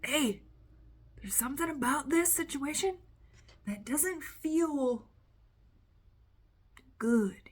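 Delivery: gently flag a doubt, stretching it out; inner intuition speaking. Retold or imitated. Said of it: "your intuition is your higher self".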